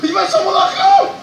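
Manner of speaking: very high, loud cry of alarm; alarmed shout. Real, not acted. Streamer seeing the beast go for his pen, high alarmed cry. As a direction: shriek as it heads for your pen. Dino heads to player's pen.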